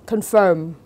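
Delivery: "confirm" with the stress on FIRM; second-syllable stress. Shown as a demonstration. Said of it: "dấu nhấn sẽ rơi vào âm tiết thứ hai". (đọc "confirm", nhấn vào âm tiết thứ hai)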